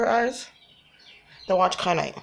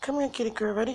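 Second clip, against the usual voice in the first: normal talking voice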